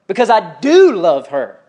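slow, with "do" drawn out and punched; stress on do. Real, not acted. Father explaining discipline of his little girl, "do" stressed emphatically. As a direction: lean hard on "do". Contrast with don't love her.

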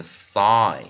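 "sigh" loud and drawn out; long vowel. Shown as a demonstration. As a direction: stretch the eye sound in "sigh". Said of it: "我们念long vowel".

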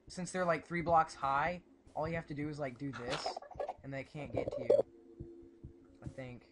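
ordinary talk

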